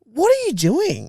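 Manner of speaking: high, drawn-out disbelieving question; exasperated. Retold or imitated. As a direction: stretch it out in mock outrage. Exasperated reaction to parents.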